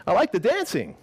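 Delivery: high upbeat boss voice; boss's cheerful praise. Retold or imitated. New boss cheering the taco worker, high and upbeat. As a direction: chirp it like a pleased new manager.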